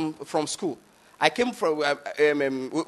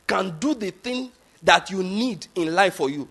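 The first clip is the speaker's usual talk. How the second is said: ordinary delivery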